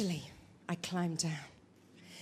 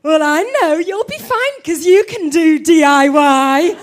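slow, loud laughing tease; husband gloating with a laugh at her DIY boast; laughing taunt; 11 dB louder than usual. Retold or imitated. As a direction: chuckle while throwing her words back. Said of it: "he's taunting me".

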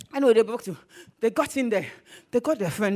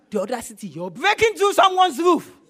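loud, raised, incredulous scolding; indignant outrage; 2 semitones higher, 7 dB louder than usual. Acted. House owner confronting roof breakers, indignant.

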